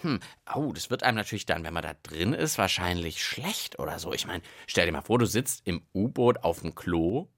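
ordinary talk, nothing marked

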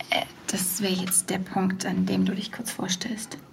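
hushed whisper, breath only; whispered prompt. Real, not acted. Host whispers a cue to Jens, whispering. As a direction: slip him the cue under your breath.

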